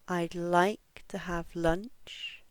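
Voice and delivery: slow, "like" and "lunch" long and strong; stress on LIKE, LUNCH. Shown as a demonstration. Teacher has learners listen, slow stress on "like" and "lunch". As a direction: lean on "like" and "lunch", "to have" light. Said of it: "this stress stays in the same place".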